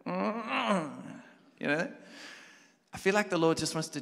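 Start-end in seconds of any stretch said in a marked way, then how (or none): 0.1-0.9 put-on grumpy child. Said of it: "And I'd be like".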